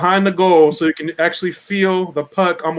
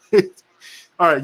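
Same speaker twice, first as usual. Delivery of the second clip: ordinary talk, nothing marked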